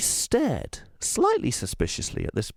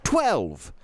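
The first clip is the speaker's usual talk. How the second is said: put-on firm, raised girl voice; loud; 7 semitones higher than usual. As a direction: say it loudly and proudly. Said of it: "said Chloe loudly".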